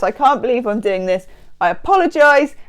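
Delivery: ordinary talk, nothing marked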